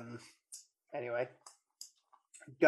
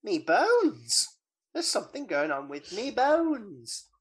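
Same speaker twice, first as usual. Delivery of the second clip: mock Freddy; 6 semitones higher, 4 dB louder than usual. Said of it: "and then he goes".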